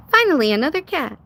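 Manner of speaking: ordinary talk, nothing marked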